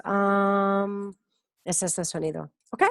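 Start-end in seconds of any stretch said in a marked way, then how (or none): 0.0-1.1 "um" drawn out on one level note; thinking hesitation sound. Shown as a demonstration. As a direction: hold "um" as if searching for a word. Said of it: "cuando estás pensando y dices". (um largo y sostenido, como pensando)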